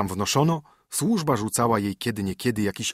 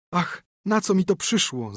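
lament; 8 semitones higher than usual. A narrator's reading. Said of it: "zawołała".

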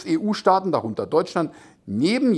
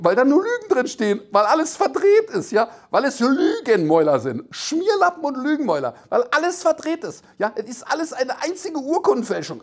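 high-pitched, swinging mock outrage; mock indignant rant; 7 semitones higher than usual. Host railing at the Spiegel as "evil tongues", mock indignant. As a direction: rail theatrically, stretching the key insults.

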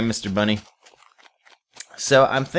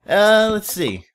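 high, loud playful let's see; playful high sing-song; 12 semitones higher, 6 dB louder, much slower than usual. Real, not acted. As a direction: chirp it out.

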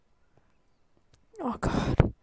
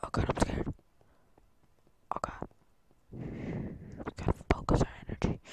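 hushed, breathless imitation of a scared player; mocking whispered panic; 11 dB quieter than usual. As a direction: whisper as if hiding in fear. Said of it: "be like".

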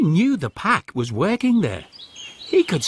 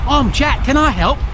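higher, louder keen offer; eager Jack again; 8 semitones higher, 5 dB louder than usual. A narrator's reading. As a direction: try once more, hopeful. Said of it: "asked Jack".

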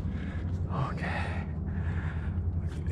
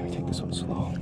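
whispering; 5 dB quieter than usual. Real, not acted. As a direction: breathe the words out softly. Climber whispering to himself on wall.

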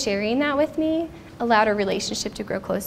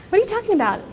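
high, astonished "What are you talking about?"; husband's startled question; 10 semitones higher, much faster than usual. Retold or imitated. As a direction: voice the husband's disbelief, pitched up. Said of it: "It's like".

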